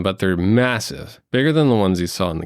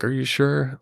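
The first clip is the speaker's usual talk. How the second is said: sad horse look; 6 semitones higher than usual. Retold or imitated. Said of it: "gives you a sad, like".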